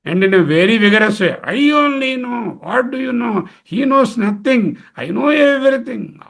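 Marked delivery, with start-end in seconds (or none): 1.4-6.2 pitched up, puffed-up know-it-all claiming all knowledge; hammed-up boastful "I know everything"; mock arrogant boast. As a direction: lightly play the smug know-it-all who thinks only he knows. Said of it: "Oh, even emphatically".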